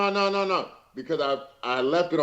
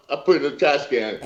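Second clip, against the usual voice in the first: usual speaking voice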